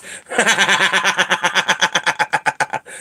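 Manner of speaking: long high cackling laugh. Real, not acted. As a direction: crack up in a rapid, drawn-out cackle. Amused at Scottish cat voice.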